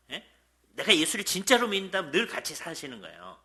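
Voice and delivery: usual speaking voice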